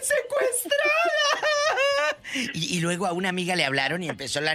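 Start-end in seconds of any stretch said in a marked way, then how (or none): 0.0-2.2 much higher, louder mock sobbing cry for mom; mocking the scam caller's fake crying; imitating crying plea. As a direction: ham up a kidnapped daughter wailing. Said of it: "llore y llore".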